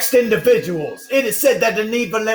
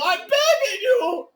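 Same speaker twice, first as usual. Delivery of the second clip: slow, high crying plea; tearful begging; 10 semitones higher than usual. Acted. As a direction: sob out the plea.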